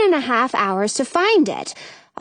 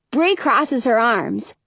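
plain reading voice

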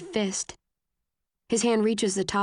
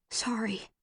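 soft breathy whisper of an apology; barely voiced, tearful; 2 semitones higher, 7 dB quieter than usual. A narrator's reading. Girl whispering sorry to him through tears. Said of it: "I say".

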